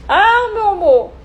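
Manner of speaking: high, drawn-out tender coo; sweet relaxed. Shown as a demonstration. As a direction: coo it like there's nothing at stake. Voice actor demoing low-risk calm line.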